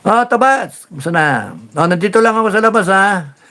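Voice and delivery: high, quick friendly greeting; greeting Bato outside the Senate, high and quick; cheery hello. Retold or imitated.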